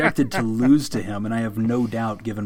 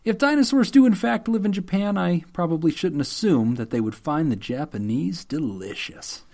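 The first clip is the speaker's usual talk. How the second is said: higher-pitched formal letter reading; deadpan public statement, pitched up; mock apology letter; 5 semitones higher than usual. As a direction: read it out like an official apology. Reads out second apology letter.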